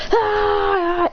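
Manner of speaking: high, drawn-out "aah"; mock gamer scream. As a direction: imitate a frantic button-masher's cry. Spamming buttons in a game.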